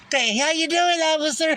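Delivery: high, sing-song hello; silly high greeting. Retold or imitated. Teasing a police officer with a silly hello. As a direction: greet the cop in a goofy high voice. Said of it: "playful high greeting to officer".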